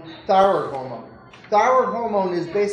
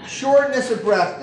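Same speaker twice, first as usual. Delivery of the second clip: ordinary talk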